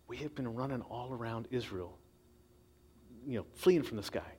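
soft, pressing, hurried near the end. Acted. David's men pressing him to kill Saul, hushed and urgent. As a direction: egg him on under the breath.

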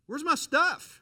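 high, loud startled yell; alarmed shout. Retold or imitated. Roommate sees cleaned room.

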